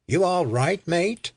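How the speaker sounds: put-on Australian accent. A narrator's reading. Backpacker checks on a stranger after the quake, Australian accent. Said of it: "asked in a strong Australian accent".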